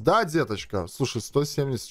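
normal talking voice

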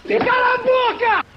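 high, slow, loud mocking echo; mock shout. Echoing his friend's shut up in a loud mock yell. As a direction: throw it back at him, hammed-up.

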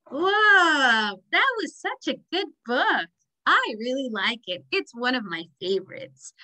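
ordinary delivery

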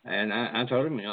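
normal talking voice

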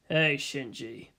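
slow, low, soft drawl. Acted. Gendo greeting his son from above, low slow drawl. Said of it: "he drawled out".

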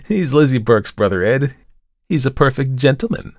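high, lilting chatter; chatty young model. A narrator's reading. Model defends her beau to the artist, chatting brightly.

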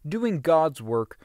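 usual speaking voice

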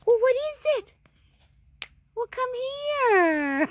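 high, sing-song pet voice, drawn slow; puppy talk. Real, not acted. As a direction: coax the puppy sweetly, slowly. Sweet-talking the puppy.

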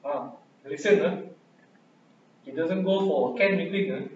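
ordinary talk

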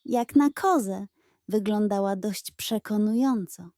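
ordinary delivery

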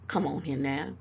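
ordinary talk, nothing marked

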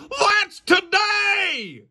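high, slow gasping shout of surprise; excited shout. Acted. Dog puppet shouting at his roommate in surprise. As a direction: yell it out as it dawns on you. Sudden birthday realization gasp.

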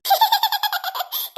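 high, light laugh; amused giggle. Acted. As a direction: giggle at the teasing. Child amused by the teasing.

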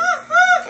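squealing burst of laughter; high shrieking laugh. Real, not acted. Laughing at chaotic game.

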